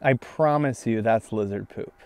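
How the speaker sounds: usual speaking voice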